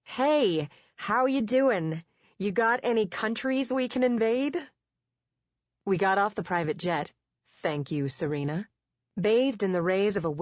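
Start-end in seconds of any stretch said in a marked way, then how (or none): 0.0-4.6 put-on a Brit hamming up a brash American accent; mock American accent. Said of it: "And I'm going to be an American".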